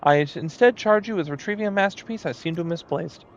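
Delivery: high, showy moogle painter; moogle artist voice. Acted.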